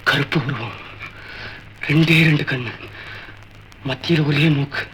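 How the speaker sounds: low, hushed suspense with long pauses; spooky storytelling. Acted. Son telling father a horror story, spooky and hushed. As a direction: creep him out, softly and haltingly.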